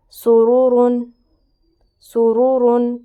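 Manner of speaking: loud, drawn-out Quranic recitation with a heavy ر in سرورن; slow Quran recitation. Shown as a demonstration. Teacher reciting for students, loud and drawn-out. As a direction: chant سرورن slowly and loudly, with the ر full and heavy. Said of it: "را کے اوپر دو پیش آ گئے".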